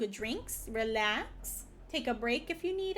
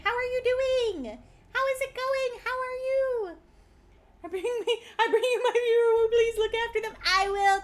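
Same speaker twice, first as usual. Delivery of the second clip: high, loud, bubbly streamer voice; cute anime streamer; 8 semitones higher, 6 dB louder than usual. Acted. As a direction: gush over the guest. Greets the raiding streamer.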